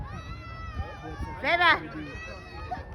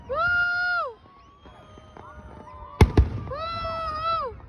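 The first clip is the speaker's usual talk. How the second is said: long, high whoop; cheering woo; 11 semitones higher, 4 dB quieter than usual. Real, not acted. Cheering the Canada Day fireworks, high whoop. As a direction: give a high, drawn-out woo.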